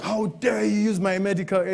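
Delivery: high, indignant father's demand; mock furious father; dad's outrage. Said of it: "And he's like".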